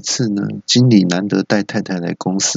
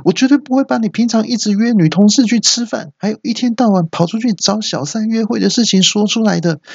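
much higher, blurting it out for all to hear; loud announcement; 14 semitones higher than usual. Acted. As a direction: loudly reassure the boss, oblivious. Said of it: "很大声的说". (朋友大声对经理保证，毫无顾忌地喊出来)

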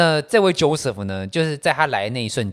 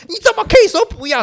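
put-on very high, loud indignant drunk; mock the drunk's outrage; 醉汉质问; 10 dB louder than usual. 醉友质问后扇巴掌. (醉醺醺的高声质问)

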